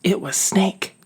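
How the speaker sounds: plain reading voice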